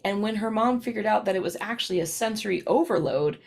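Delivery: ordinary talk, nothing marked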